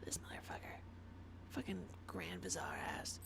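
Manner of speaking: soft, voiceless cursing under breath; whispered grumble. Real, not acted. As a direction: hiss the insult quietly. Muttering insults at game villain.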